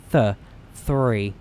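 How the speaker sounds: Th- three, th drawn out first; th sound. Shown as a demonstration. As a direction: stretch the th before three. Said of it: "It's a the sound".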